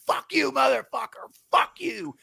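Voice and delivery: very high, held-back shout; mock yelling. Cabbie curses rider as they part, mock yell. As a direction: imitate a distant cursing match. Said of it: "yelling at each other".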